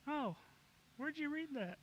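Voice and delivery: put-on high-pitched little boy voice; kid voice. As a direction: play an eleven-year-old son, pitched high. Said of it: "I'm in character as a fucking kid".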